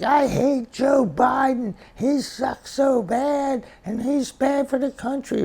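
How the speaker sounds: high, loud, slow mock-kid rant; ranting child caller. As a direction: mimic an angry twelve-year-old on talk radio. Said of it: "this is twelve-year-old Johnny from Des Moines".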